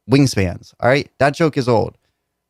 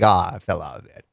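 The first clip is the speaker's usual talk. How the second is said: mock J. Cole; 9 semitones lower, 4 dB quieter than usual. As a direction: lower mocking impression of a washed-up rapper. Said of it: "You're just like".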